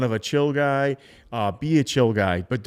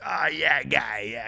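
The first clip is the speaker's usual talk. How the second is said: put-on New England accent; 3 dB quieter than usual. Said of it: "heavy New England accent".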